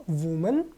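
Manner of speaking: वुमन said WU-mun, with a short u; वुमन with the oo sound, not the i of विमेन; singular form. Shown as a demonstration. Teacher contrasts the singular with the plural, short u. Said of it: "अगर ये singular हो तो हम लोग इसको पढ़ते हैं".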